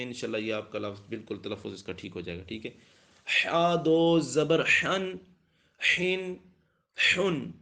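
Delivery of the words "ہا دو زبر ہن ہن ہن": ہا دو زبر ہن spelled out slowly and higher; slow recitation. Shown as a demonstration. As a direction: chant the spelling like a Qaida class. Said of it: "اب ہن اور ہن میں کتنا فرق ہے".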